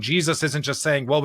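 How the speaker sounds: ordinary talk, nothing marked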